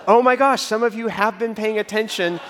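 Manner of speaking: high, loud playful "Oh my gosh"; excited exclamation. Real, not acted. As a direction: burst out in mock amazement.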